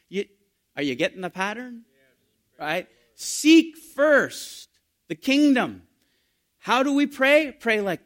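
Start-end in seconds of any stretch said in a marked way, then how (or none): 3.1-8.1 loud, high, slow scripture; booming sermon quote; preaching at full force. Acted. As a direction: proclaim it from the pulpit. Preaching climax.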